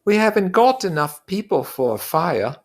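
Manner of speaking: high, earnest schoolboy voice, slowing to insist; a fussy boy pleading for fairness; Piggy's voice. Acted. Piggy voiced in read-aloud story.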